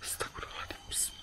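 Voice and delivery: frightened whisper under breath; scared whisper. Real, not acted. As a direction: breathe it out in fear. Hears a strange sound.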